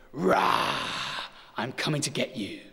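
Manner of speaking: breathy growled rah, then a light mock monster threat; a dad playing monster for his kids, gently; playful monster roar. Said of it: "and I go".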